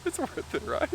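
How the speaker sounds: fighting back laughter; laughing. Real, not acted. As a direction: say it while trying not to laugh.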